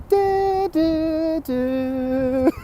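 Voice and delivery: high sung door chime. Retold or imitated. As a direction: sing the chime tones high.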